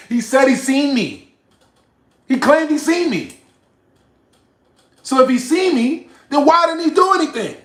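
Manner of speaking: high, loud, incredulous shouting; indignant yelling. Real, not acted. Streamer disputing his rival's claim to have seen him, indignant yelling. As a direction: yell the challenge in outrage.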